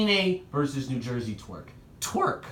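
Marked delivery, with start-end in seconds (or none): 2.0-2.4 voice cracking. Real, not acted. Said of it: "My voice just cracked".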